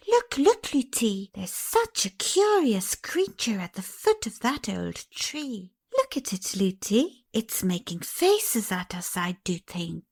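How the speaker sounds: put-on eager little girl pointing; excited calling.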